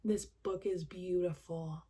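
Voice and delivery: slow, awed sincere quote; a slow, moved hush of admiration. Retold or imitated. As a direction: savor each word slowly in awe. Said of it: "that was kind of my moment where I was like".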